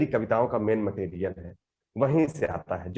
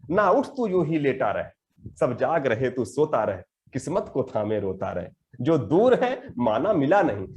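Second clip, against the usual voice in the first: slow, rhythmic poetry reading; poem recitation; 2 semitones higher than usual. Acted. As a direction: recite each line with measured weight.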